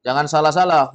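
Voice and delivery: normal talking voice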